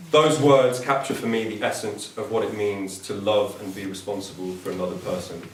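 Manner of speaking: usual speaking voice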